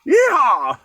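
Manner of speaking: high, loud yeehaw; cowboy whoop. Pretend. Cook capping his American accent bit, whooping like a cowboy. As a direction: let out a mock cowboy holler.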